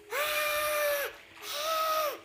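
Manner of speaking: chillido muy agudo. Acted. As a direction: chilla de pronto con voz aguda. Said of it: "les chilló con voz súbitamente aguda". (el rey chilla agudo a los trabajadores)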